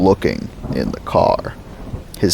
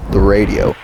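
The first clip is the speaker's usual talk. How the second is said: ordinary delivery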